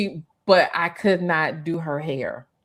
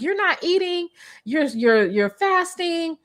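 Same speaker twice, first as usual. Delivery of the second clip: high client voice; 10 semitones higher, slower than usual. Retold or imitated. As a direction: raise it high, coaxing client.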